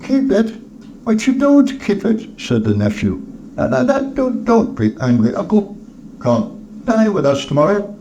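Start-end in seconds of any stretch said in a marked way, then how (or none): 0.0-2.3 high-pitched nephew's protest; incredulous nephew. Acted. Nephew exclaims back at uncle. 3.6-7.9 high-pitched pleading nephew; nephew, placating. Acted. Nephew's voice in the story.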